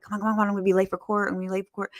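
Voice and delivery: quick, tumbling words; imitating someone running late, fast and under the breath; hurried. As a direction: retell a frantic rush through security. Retelling rushing through courthouse security.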